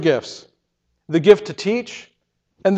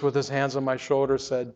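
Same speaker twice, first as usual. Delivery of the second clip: usual speaking voice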